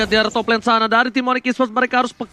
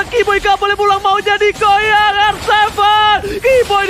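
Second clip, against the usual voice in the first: excited call; 5 semitones higher, 3 dB louder than usual. Real, not acted. Teamfight hype call.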